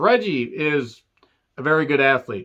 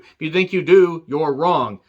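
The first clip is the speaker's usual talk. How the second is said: ordinary delivery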